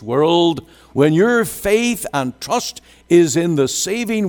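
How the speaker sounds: high-pitched, emphatic preacher's delivery; fervent preaching. Real, not acted. Preacher driving home Christ's unchangeable priesthood to the congregation, fervent. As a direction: preach it with rising fervour, stretching the key words.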